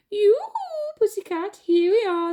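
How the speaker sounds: very high loud taunting call; teasing singsong. Acted. Mice luring the cat onto the beam, high teasing call. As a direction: lure the cat up mockingly. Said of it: "they called".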